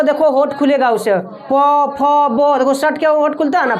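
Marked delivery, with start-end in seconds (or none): 1.1-2.6 very slow, each syllable drawn out for the class; slow demo syllables. Shown as a demonstration. Teacher showing students the lip sounds, said very slowly. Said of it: "अब पो फो बोलो".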